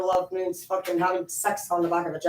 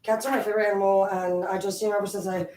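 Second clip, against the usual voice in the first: ordinary delivery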